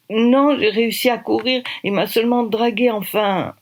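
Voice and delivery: put-on a shaken young woman explaining herself; lightly voiced frightened girl; young girl's voice. Shaken girl after an assault.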